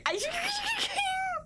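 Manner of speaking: very high squealing cry; high drawn-out squeal. Real, not acted. Streamer reacts to viewers still being there, high drawn-out squeal. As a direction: let out a long, high squeal.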